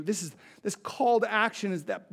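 ordinary talk, nothing marked

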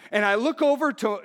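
usual speaking voice

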